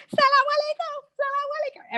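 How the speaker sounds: calling out greetings to strangers with delight; excited greeting. Retold or imitated. Greeting Muslims on the London streets, a high excited call. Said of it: "I was like".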